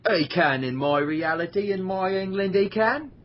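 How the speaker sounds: teasing Cockney, drawn out; insisting in a put-on Cockney accent to wind up a friend; mock Cockney. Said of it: "I keep doing that just to piss you off".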